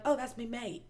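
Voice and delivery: imitated Aussie with "me" for "my"; Australian accent. Quoting her Australian ex about his friend, light Australian accent. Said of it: "he'd be like".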